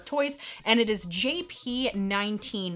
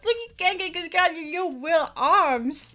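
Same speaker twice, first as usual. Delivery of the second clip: cutesy teasing voice; 6 semitones higher than usual. Pretend. Vlogger teasing a T-Rex toy, cutesy voice. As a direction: coo mockingly in a raised, put-on little voice. Mock baby-talk over a cute toy.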